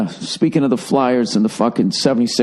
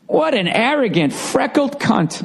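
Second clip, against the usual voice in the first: self-mocking; 11 semitones higher, slower than usual. Real, not acted. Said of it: "this is me being an egomaniac".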